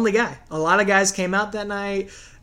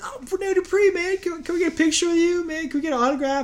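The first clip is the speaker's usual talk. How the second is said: much higher eager fan, fast pleading; high starstruck fan voice; 12 semitones higher, faster than usual. Retold or imitated. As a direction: gush like a starstruck young fan. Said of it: "And we're like".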